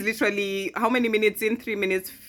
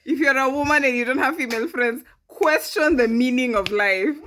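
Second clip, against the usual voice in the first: loud, high, emphatic lecturing; impassioned rant; 8 semitones higher, 6 dB louder than usual. Real, not acted. Urging women to have female friends, impassioned rant. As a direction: hammer the point home, raised voice.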